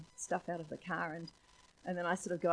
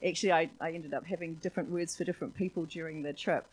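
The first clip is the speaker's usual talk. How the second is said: normal talking voice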